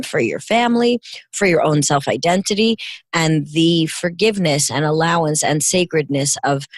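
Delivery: ordinary delivery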